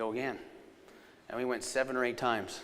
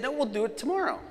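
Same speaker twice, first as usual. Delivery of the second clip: mock pleading, pitched way up; high-pitched protest; 11 semitones higher than usual. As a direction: put him off in a high voice. Husband protests in retold argument.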